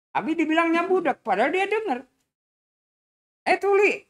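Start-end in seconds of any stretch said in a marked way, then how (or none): 3.5-4.1 calling out. Acted. Stranger calls Dulah deaf.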